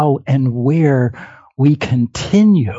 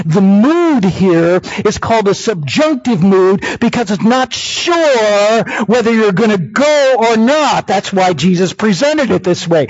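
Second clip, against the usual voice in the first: ordinary delivery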